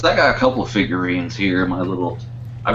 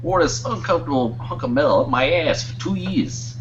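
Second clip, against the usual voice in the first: higher, pushy Pesci voice; Joe Pesci impression; 6 semitones higher, 3 dB quieter than usual. Shown as a demonstration. Friend tries Walken, lands on Pesci. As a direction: do Walken but land in Pesci. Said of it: "that's a Joe Pesci".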